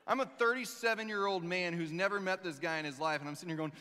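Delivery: ordinary talk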